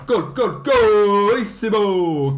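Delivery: long, drawn-out loud high goal cry; goal celebration. Real, not acted. Gamer celebrating a winning goal, drawn-out goal roar. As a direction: stretch the goal call out in triumph.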